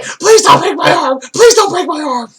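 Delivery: very high, whiny pleading imitation; mock begging Stephanie. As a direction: squeal it like a scared victim begging. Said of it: "And she's like".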